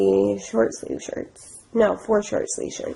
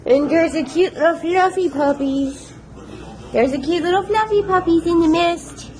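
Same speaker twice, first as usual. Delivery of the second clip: high-pitched puppy-greeting coo; cooing at puppies; 8 semitones higher than usual. Real, not acted. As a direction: gush in a high singsong at cute puppies.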